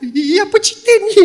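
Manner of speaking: high startled question; surprised husband. Retold or imitated. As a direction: caught off guard.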